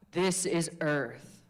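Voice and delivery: slow, grounded chest voice; earth voice. Shown as a demonstration. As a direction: speak low and slow from the chest. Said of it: "find that chest voice".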